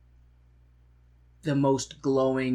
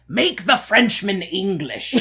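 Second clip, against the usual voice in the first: slow, higher hammed-up decree; mock grand command; 5 semitones higher, 4 dB louder, slower than usual. Imagined composer jabbing at the French, hammed-up command. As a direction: proclaim it like a silly edict. Imagines Webber's pompous order.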